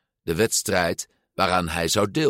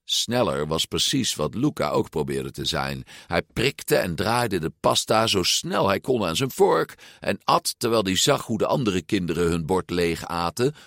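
ordinary delivery, nothing marked

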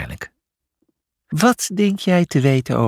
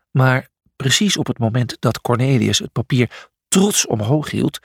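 ordinary delivery

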